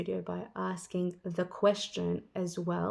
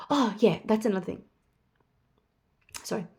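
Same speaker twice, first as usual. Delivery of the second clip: usual speaking voice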